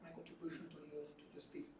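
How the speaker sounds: hoarse, almost gone whisper; lost voice. Real, not acted. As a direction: voice barely there, raspy and breathy. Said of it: "I have no voice".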